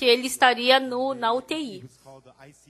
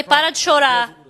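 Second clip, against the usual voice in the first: ordem firme; 3 semitones higher, 8 dB louder than usual. Retold or imitated. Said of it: "Eu falei".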